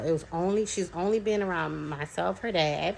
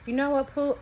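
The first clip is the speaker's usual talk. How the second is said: playful; 8 semitones higher, faster than usual. Retold or imitated. Mother coaxing child into game.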